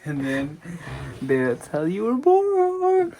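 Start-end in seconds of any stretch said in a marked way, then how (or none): 1.2-3.1 put-on high, slow baby-talk voice; high sing-song. Dad telling the baby how he was born, high and slow. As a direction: coo it to the baby. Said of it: "dad addressing the baby".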